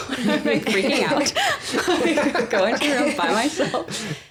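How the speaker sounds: laughing while telling; giggly, amused retelling. Real, not acted. Laughing at own freak-out.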